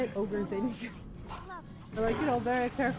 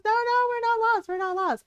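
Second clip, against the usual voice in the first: high, louder mock protest; dad's insistent denial; 11 semitones higher, 5 dB louder than usual. Dad denying to stepmom that they're lost, high mock protest. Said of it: "My dad says".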